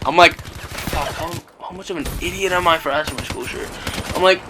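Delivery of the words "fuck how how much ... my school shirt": hushed aside to yourself; soft panicked inner voice, starting in a whisper; muttered self-reproach. Retold or imitated. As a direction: curse yourself under your breath. Inner thought while mom waits.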